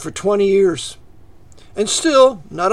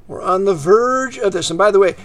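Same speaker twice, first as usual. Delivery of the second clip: ordinary delivery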